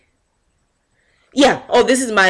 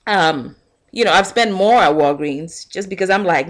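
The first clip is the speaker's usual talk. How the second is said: usual speaking voice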